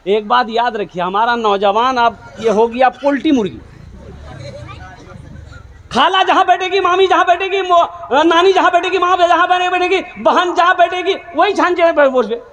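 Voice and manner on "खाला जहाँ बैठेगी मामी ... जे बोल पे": high, mocking sing-song listing. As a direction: poke fun at the lazy youth in a raised voice. Mocking women-clinging youth. (ऊँची आवाज़ में मज़ाक उड़ाते हुए)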